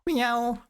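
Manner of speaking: "Meow" as high, level NYOW; imitating Google voice. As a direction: mimic the Chinese machine's high-toned "Meow". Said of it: "The Google voice".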